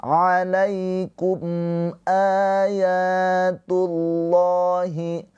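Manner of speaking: ayatullahi with the a held long; mad badal. Shown as a demonstration. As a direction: stretch the opening a of ayatullahi. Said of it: "mad badal ya".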